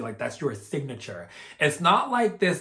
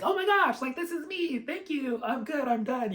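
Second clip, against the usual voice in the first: much higher, light and giddy voice; mock heavenly bliss, high and airy; blissed-out higher self; 12 semitones higher than usual. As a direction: float up into a delighted high voice. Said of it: "It was like".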